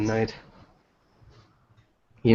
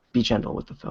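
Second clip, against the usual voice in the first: whispering; 7 dB quieter than usual. Acted. Teasing whisper about phone.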